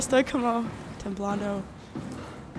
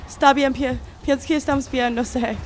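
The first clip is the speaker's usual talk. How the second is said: ordinary delivery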